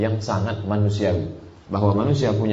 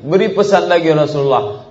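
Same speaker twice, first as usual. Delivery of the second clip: louder and higher, pressing the Prophet again; insistent repeated plea; 8 semitones higher, 7 dB louder than usual. Acted. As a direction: push the request harder, almost calling out. Man asks again, insistent.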